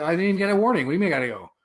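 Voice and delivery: mildly outraged pushback; indignant protest. Retold or imitated. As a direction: protest being thrown out. Retold protest at being ejected.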